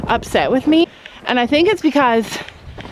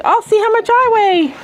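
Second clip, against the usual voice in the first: voicing the pig; 10 semitones higher than usual. Vlogger voicing a piglet heading to the scale, squeaky high. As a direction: give the piglet a silly high little voice. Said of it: "She's like".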